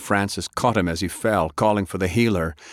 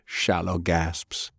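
usual speaking voice

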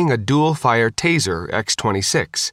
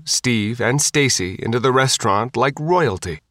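plain reading voice

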